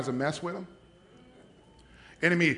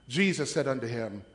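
ordinary talk, nothing marked

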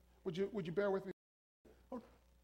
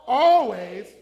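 much louder, enthusiastic call; 14 dB louder than usual. Shown as a demonstration. Preacher getting the congregation to repeat the word, loud and enthusiastic. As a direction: belt it out to rouse the crowd. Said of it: "say that again with enthusiasm".

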